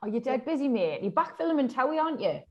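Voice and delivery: ordinary delivery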